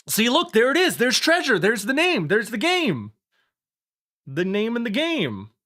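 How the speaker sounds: high, loud excited shouting. Real, not acted. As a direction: shout it out, thrilled. Excited shouting at title screen.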